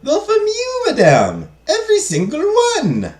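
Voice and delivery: very high, put-on accented servant voice; eager maid, excited. Maid tells madame all the letters are hers, excited.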